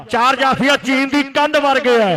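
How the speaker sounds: ordinary talk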